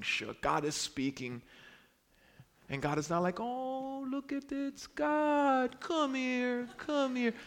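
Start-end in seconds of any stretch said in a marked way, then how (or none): 3.4-7.3 high-pitched cutesy coo; mock cooing. Preacher mocks treating God as something cute, mock cooing. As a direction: coax it like a tiny pet.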